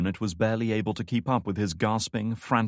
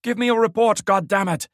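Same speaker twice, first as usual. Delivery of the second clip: angry radio shout; 13 semitones higher, 8 dB louder than usual. A narrator's reading. Radio commander demanding answers.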